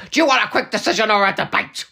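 loud, high theatrical outburst; big mock-dramatic demand; camp actor impression. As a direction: fire it off in hammed-up exasperation. Acted camp quote from the show.

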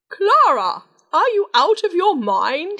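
high, loud furious bellow; bellowing king. Acted. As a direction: roar at your daughter in outrage. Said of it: "he bellowed".

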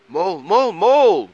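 high, loud urgent calls; crying out. A narrator's reading. Troll calls Mole about his tooth, crying out. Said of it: "cried Troll".

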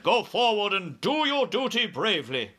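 high, rousing officer's command; army commander giving orders. A narrator's reading. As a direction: urge the troops on to their duty.

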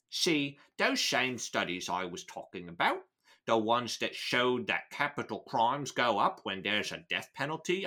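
put-on Cockney villain's lecture; Cockney accent. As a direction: hold a hammy Cockney British accent. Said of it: "my ability to do a Cockney British accent".

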